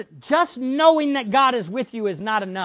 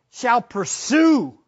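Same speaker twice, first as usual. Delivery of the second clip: "shall pursue" drawn out slow and louder; stress on pursue; 5 dB louder, much slower than usual. Shown as a demonstration. Preacher correcting the translation, slow stressed "shall pursue". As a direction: lean hard on "pursue". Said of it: "it was better translated".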